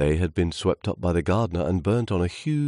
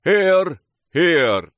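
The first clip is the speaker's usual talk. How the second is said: high, loud cheer of agreement; crying out; 13 semitones higher, 9 dB louder than usual. Acted. Twigs and branches backing the trunk, loud cry. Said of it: "cried the twigs and branches".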